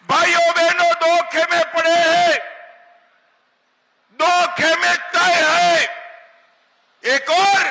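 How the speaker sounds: loud, high rally oratory; booming political rally speech. Real, not acted. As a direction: boom it out to a crowd.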